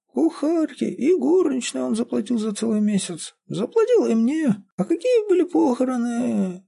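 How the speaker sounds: put-on very high, plaintive old woman; whining old caretaker. A narrator's reading. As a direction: answer in a high, whiny old voice. Old woman tells of the funeral.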